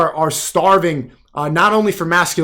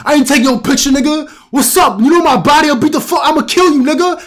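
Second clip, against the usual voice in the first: high-pitched mock yell of an angry threat; yelling neighbor; 9 semitones higher, 4 dB louder than usual. Neighbor yelling back across the projects at his accuser, mock angry shouting. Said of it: "yelling back and forth".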